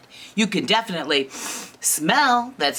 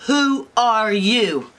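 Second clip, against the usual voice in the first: slow, drawn-out echo, raised pitch; indignant bears; 4 semitones higher, 4 dB louder, slower than usual. Acted. Bears repeat the intruder's question, slow and indignant. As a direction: stretch the question out incredulously.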